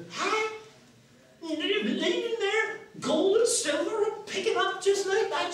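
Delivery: put-on very high, excited old countrywoman; old woman. Washerwoman gloats over gold and silver, high old-woman glee. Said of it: "how old and worn out she is".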